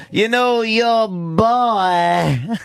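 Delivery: high-pitched, stretched-out Southern drawl; Southern accent. Retold or imitated. Mimicking a politician's clip for laughs, hammed-up Southern accent. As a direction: exaggerate the Southern accent, dragging out "boy". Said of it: "He's got the accent".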